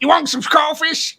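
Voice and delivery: put-on Louisiana accent. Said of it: "I think I can tell the Louisiana accent".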